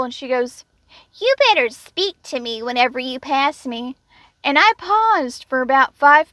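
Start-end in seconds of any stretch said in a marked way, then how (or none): 1.2-3.9 mock playful coworker. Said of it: "tries to be playful".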